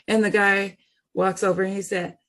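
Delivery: ordinary talk, nothing marked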